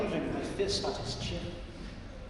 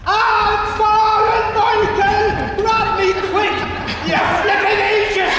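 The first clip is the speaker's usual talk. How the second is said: much higher, much louder, slow terrified shout; panicked yell; 14 semitones higher, 12 dB louder, slower than usual. Acted. Falling old man, panicked shout. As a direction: Pat shouting at Michael as he falls.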